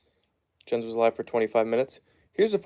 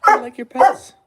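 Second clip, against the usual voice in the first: much higher and louder, squealing sing-song; excited dog talk; 12 semitones higher, 9 dB louder than usual. Real, not acted. Owner gushing over his dog Layla, high and bright. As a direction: gush at the dog like a puppy.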